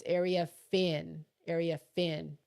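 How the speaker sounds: Area Finn with the weight on Finn; slow and careful, lean into Finn; stress on Finn. Shown as a demonstration. Showing viewers how to say her name, stress on Finn. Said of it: "is where you put the emphasis on".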